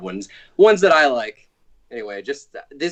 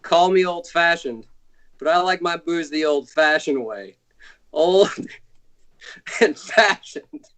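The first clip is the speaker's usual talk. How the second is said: hammy lounge comic, punchline drawn out; corny nightclub comedian voice; old borscht belt comic; 5 semitones higher than usual. Retold or imitated. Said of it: "here's my impression of someone who is about to order an old-fashioned".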